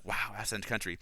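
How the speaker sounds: softly imitated wonder; awed. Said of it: "in an awed voice".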